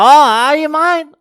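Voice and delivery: very high, loud mock-bogan whoop; enthusiastic bogan tourists. Bogan tourists discovering a fellow Australian, enthusiastic whoop. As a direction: imitate a thrilled Aussie shouting to a countryman. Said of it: "They were at least enthusiastic".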